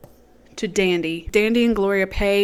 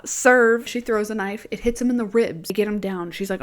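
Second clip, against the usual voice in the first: normal talking voice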